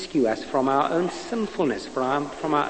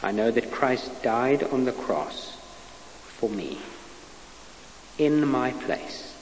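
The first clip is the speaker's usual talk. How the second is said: prayer; 4 semitones lower than usual. Acted. Preacher leads the listeners in a prayer of repentance. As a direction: lead them gently through the prayer.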